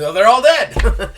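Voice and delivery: loud, high blurted announcement; hammed-up shouting of bad news; mock-dramatic outburst. Hero telling a soldier his kinsmen's fate, mock-dramatic outburst.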